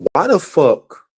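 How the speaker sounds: ordinary delivery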